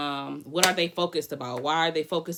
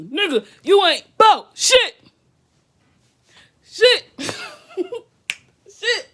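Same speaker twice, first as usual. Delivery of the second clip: high, loud laughing yell; laughing shriek; 12 dB louder, slower than usual. Real, not acted. Streamer shrieking with laughter at her own joke. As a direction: crack up loudly.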